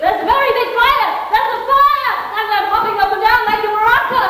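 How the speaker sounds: high-pitched, put-on alarm; feigned fire panic. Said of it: "have to be real".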